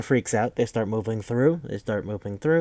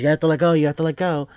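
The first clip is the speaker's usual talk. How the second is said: higher and louder, desperate cry to let go; panicked yell; 6 semitones higher, 7 dB louder, faster than usual. Retold or imitated. Frantic retold yell. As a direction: Kate yelling at the colonel gripping her ankle, panicked. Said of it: "She goes".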